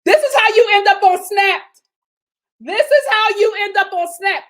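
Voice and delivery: high, loud disbelieving outburst; outraged exclaim. Real, not acted. Vlogger shouting in disbelief at the husband. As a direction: shout it in shock. Outraged reaction to cheating line.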